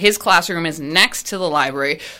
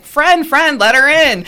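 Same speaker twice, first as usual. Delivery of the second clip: high, loud call to the class; 8 semitones higher, 6 dB louder than usual. Retold or imitated. Teacher calling to students to let principal in, loud and high. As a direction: call across the room to let her in.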